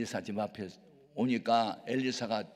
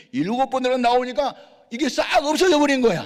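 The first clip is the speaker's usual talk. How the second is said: rising to a high, loud cry of wonder; thrilled preacher announcing the healing; excited climax; 14 semitones higher, 12 dB louder than usual. A narrator's reading. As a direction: proclaim the miracle at full pitch.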